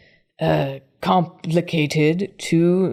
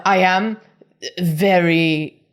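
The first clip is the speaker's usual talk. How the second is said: ordinary delivery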